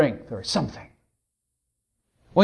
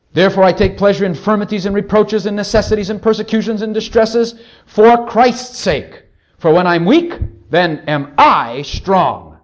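scripture reading; 5 dB louder than usual. Acted. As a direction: roll through the list, then stretch the climax. Reads the verse to congregation.

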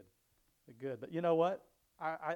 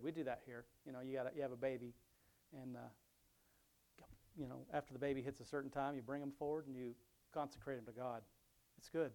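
usual speaking voice

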